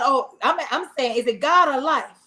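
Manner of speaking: usual speaking voice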